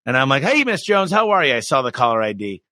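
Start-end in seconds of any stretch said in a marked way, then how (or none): 0.4-1.5 high, upbeat hello; eager phone greeting. Retold or imitated. Owner answers the customer's callback, eager and high. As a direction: sound thrilled she called back.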